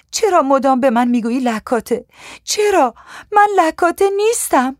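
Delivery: high-pitched, distressed plea, climbing steeply; tearful sobbing protest. A narrator's reading. Young woman to her abuser after starting to sob, tearful high protest. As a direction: protest through sobs, voice pushed up high. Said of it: "شروع کردم به حق حق کردن".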